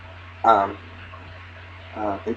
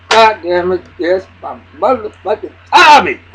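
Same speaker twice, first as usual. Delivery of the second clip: loud, high cheer of triumph; cheering for Tommy; 8 semitones higher, 10 dB louder than usual. Retold or imitated. Fan cheering Tommy as he walks in, loud delight. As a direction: shout like your guy just scored. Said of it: "I said".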